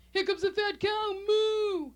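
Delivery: high, loud brother's taunt into a long moo; jeer, then bellow like a cow; teasing mooing. Retold or imitated. Brother teasing sister as fat cow, loud mocking moo. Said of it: "he starts mooing".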